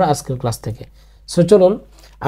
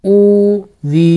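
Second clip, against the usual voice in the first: syllable by syllable; 3 semitones higher, much slower than usual. Shown as a demonstration. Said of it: "যোগ করে দেব".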